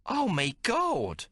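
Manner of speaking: high, slow drawn-out cry; yelling. A narrator's reading. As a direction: yell it out in shock. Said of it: "suddenly yells".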